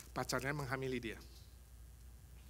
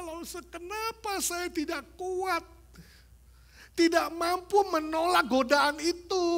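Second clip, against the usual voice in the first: put-on crying girl; 13 semitones higher, 7 dB louder than usual. Preacher voicing the sobbing teenage girl who came to him. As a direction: plead like a weeping young woman, much higher. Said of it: "Nangis-nangis. Lalu dia bilang".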